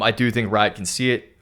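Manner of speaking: ordinary delivery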